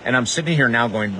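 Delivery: usual speaking voice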